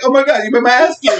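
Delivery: high-pitched pretend alarm; mock panic. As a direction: exclaim it in hammed-up horror. Imagines a victim's panicked reaction.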